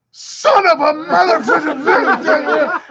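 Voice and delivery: very high, slow, laughing mock scream; furious yell. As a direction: howl the curse with a laugh. Pilot furious after losing fight.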